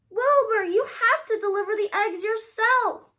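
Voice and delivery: high, loud urgent shout. A narrator's reading. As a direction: yell at a sleepy friend. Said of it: "shouted Charles".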